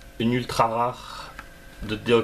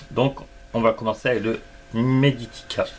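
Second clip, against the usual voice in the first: ordinary talk, nothing marked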